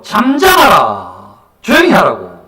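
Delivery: loud, drawn-out scolding shout; rebuking. Acted. Many people in the crowd telling the beggar to hush, loud rebuke. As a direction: order him silent at full voice. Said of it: "많은 사람들이 꾸짖어".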